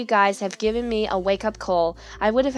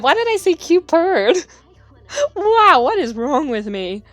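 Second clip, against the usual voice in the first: laughing at herself; 5 semitones higher, 4 dB louder than usual. Real, not acted.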